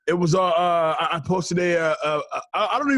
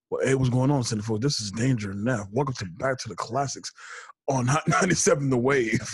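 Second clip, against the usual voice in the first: deep radio voice; 6 semitones lower, 4 dB quieter than usual. Retold or imitated. Host imitating co-host's radio intro, low smooth DJ voice. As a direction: put on a deep late-night DJ read. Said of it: "hear, like, Dave's voice".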